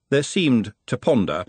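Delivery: ordinary delivery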